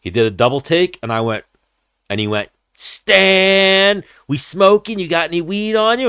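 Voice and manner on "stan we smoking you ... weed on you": very high, louder put-on voice; hammed-up stoner asking for weed; imitating a fighter spotting his smoking buddy; eager greeting.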